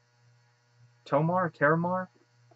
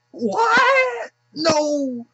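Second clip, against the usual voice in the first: high shrieking mock panic; freaking out; 7 dB louder than usual. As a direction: squeal it like a boy caught off guard. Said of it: "Terramar is like".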